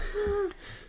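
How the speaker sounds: sudden high-pitched burst of laughter; real giggle shooting up high; high squealing laugh. Real, not acted. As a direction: squeal with delight at the joke. Laughing at Sawyer joke.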